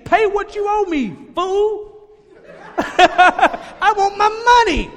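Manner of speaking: high, loud, laughing demand; furious servant. Acted. Pastor acting the unforgiving servant choking his debtor, mock-furious. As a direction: choke out a mock-furious demand for your money. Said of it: "That's my version".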